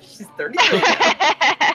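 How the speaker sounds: laughing through the words. Real, not acted. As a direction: giggle it out slowly. Laughing at her own joke.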